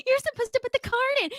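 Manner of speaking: high, fast, lightly voiced receptionist, laughing; hotel receptionist. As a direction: squeak out the clerk's correction. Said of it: "She was like".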